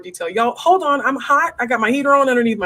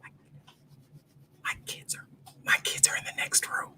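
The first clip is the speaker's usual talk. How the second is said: breathy real whisper, far softer; 17 dB quieter than usual. Real, not acted. Mom explaining the kids are next door, whispering. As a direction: drop to a true whisper, no voice. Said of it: "that I whispered to you".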